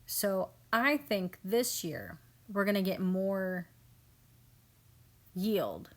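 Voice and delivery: ordinary talk, nothing marked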